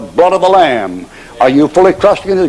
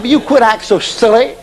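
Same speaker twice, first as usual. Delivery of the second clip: exasperated wife; 6 semitones higher than usual. Acted. Wife scolds pacing husband.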